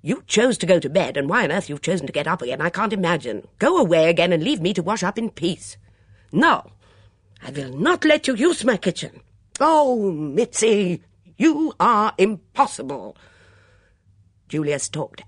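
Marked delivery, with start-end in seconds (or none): 0.0-5.8 loud, exasperated scolding; cross. A narrator's reading. Julia wanting to wash up in peace, crossly. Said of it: "said Julia crossly". 6.3-9.2 high, loud, indignant refusal; angry refusal. A narrator's reading. Mitzi refusing Julia the kitchen, angrily. 9.6-13.2 high, loud, exasperated outburst. A narrator's reading. Julia giving up on Mitzi, exasperated.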